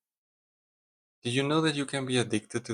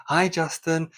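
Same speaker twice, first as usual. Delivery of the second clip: bright, higher, louder hello; cheery greeting; 7 semitones higher, 4 dB louder than usual. Retold or imitated. Coworkers greeting Justin at the office, a higher cheery hi. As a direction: greet him like a happy coworker. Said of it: "greeting you with cheery".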